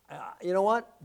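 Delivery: usual speaking voice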